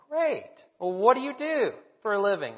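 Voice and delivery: high-pitched, hearty Tennessee twang; Tennessee accent. Retold or imitated. Old gentleman prying into the diner's job, high Tennessee accent. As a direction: imitate an over-friendly local with a Tennessee accent. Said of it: "with a good Tennessee accent".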